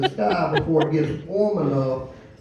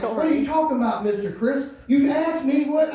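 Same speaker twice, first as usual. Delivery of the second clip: put-on higher confused teen voice; confused kid; 7 semitones higher than usual. Teen confused by his boss's question, higher voice. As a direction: play the baffled kid.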